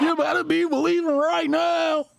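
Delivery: very high, shouted mock Midwest accent. Host echoes senator's rally cry, mocking Midwest accent. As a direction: belt it out like a parodied Midwest-accented orator. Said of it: "What accent is this?".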